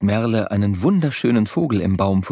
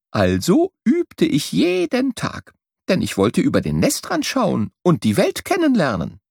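bright, lively voice of a small bird telling his adventures; the travelling bird; 7 semitones higher than usual. A narrator's reading. Travelling bird tells Merle his journey, lively and bright. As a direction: tell it like a proud little bird who saw the world.

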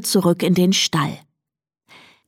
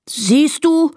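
higher, upset retort; snorting; 8 semitones higher than usual. A narrator's reading. Donkey Emil feels proved right before his friend, snorting. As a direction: huff it, pitched up. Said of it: "schnaubte Emil".

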